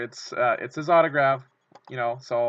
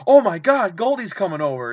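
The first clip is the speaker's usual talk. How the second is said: excited squeal; 6 semitones higher, 9 dB louder than usual. Retold or imitated. Star player jogging toward him.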